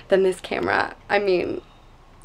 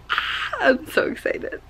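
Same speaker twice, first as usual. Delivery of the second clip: whispered excitement; 5 semitones higher than usual. Real, not acted.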